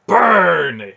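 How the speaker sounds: very loud, high, drawn-out order; will it to happen with a shout; shouted command. A narrator's reading. Boy willing his enemy to burn, commanding shout. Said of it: "he commanded, willing it to happen".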